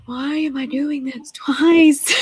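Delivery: slow, incredulous mock wail of frustration; exasperated protest. Patient retelling her protest at repeated check-in questions, exasperated.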